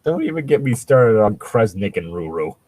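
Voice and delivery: usual speaking voice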